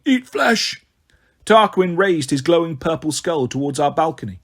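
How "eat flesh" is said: high ghoul shriek; ghoulish outburst. A narrator's reading. Ghoul losing control before his king, shrieking. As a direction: burst out with uncontrollable hunger.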